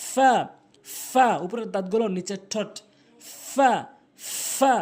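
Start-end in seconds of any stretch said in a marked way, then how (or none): 0.1-1.3 "ফা" with a long hissing f; correct pronunciation. Shown as a demonstration. Teacher models the Arabic letter, true f "ফা". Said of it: "আপনি উচ্চারণ করবেন". 3.5-4.7 "ফা" with a long hissing f; correct pronunciation. Shown as a demonstration. Teacher models the Arabic letter, true f "ফা". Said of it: "সঠিক উচ্চারণ".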